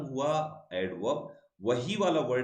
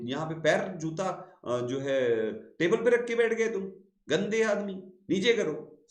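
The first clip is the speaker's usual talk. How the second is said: high, louder mock telling-off of a rude guest; mock scolding; 6 semitones higher, 3 dB louder than usual. Teacher scolds an imaginary student for his shoe on the table, mock stern. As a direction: snap at him to get his shoe off.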